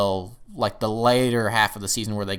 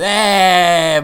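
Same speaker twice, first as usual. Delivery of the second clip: loud, raised, drawn-out "eh"; joking "eh" reaction; 7 semitones higher, 10 dB louder than usual. Retold or imitated. Four viewers reacting to a pun, knowing "eh". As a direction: let out a long knowing groan at a pun. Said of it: "kinda went".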